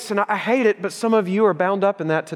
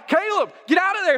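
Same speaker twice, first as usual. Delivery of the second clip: high, sharp yell across the yard; urgent yell to son; 12 semitones higher than usual. Retold or imitated. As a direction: call him off the road fast. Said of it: "I'm gonna yell".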